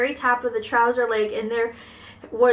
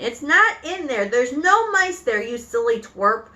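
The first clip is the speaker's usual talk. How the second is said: shouting; 3 dB louder than usual. Acted. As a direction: shout down the silly cook.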